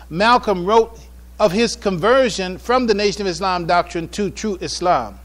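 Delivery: normal talking voice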